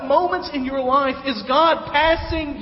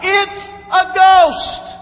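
much higher, louder, slow scream of fear; panicked shout of ghost; terrified yell; 9 semitones higher, 10 dB louder, much slower than usual. Retold or imitated. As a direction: cry it out in panic. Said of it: "And they yell out".

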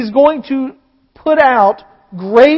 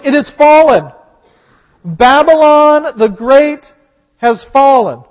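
mighty cry; 4 semitones higher, 6 dB louder, slower than usual. Acted. Preacher reading the angel's cry, loud proclamation. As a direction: proclaim it loud, "Babylon" stretched slow. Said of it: "He cried in a mighty voice".

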